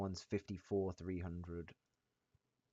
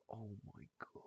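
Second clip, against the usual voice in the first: awed whisper; 13 dB quieter than usual. Real, not acted. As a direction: breathe it in awe. Stunned at profit.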